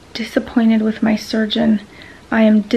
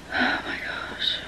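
slow, breathy whisper to herself; worn-out whispered aside; 10 dB quieter, slower than usual. Real, not acted. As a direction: exhale the words in a whisper.

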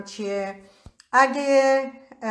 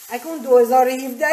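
ordinary delivery

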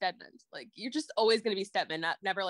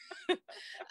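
a sudden high squealing laugh; high-pitched squeal of laughter; 6 dB quieter than usual. Real, not acted. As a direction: burst out in a squeaky giggle. Laughing at own joke.

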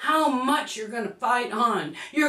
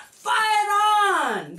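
rallying shout; 7 semitones higher than usual. Acted. Rallying cry.

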